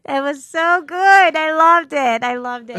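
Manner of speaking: loud, high, laughing delight; gleeful laughter. Real, not acted. Telling the host about shooting the bully, loud gleeful laughing. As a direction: cackle with real glee at the memory.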